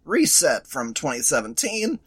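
normal talking voice

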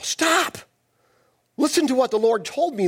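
sudden high cry of "stop"; high, abrupt prophet's order; commanding halt. Acted. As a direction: cut him off with an acted command. Samuel cuts Saul off.

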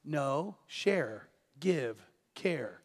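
soft, quiet voice of God; softly voiced God; gentle God. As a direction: coax gently, no, share, give. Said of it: "And God's going".

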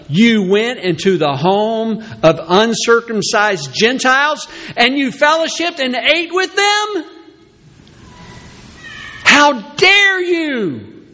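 hammed-up accusation building to how dare you; accusing church. Church leaders accusing Peter, high and scandalized. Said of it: "they accuse him of".

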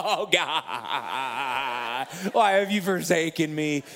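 mock anguish. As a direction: wail it like a melodramatic lament, laughing. Mock despair after rejection.

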